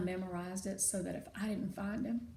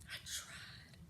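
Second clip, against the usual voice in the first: softer, barely voiced; broken whisper; 13 dB quieter than usual. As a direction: whisper it defeated. Father breaking down.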